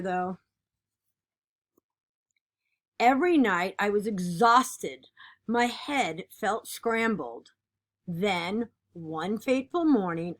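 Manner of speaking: lively, expressive picture-book reading; animated read-aloud of a kids' book; reading to children. Acted. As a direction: read it like storytime for a class.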